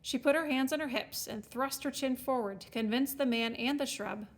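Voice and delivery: ordinary delivery, nothing marked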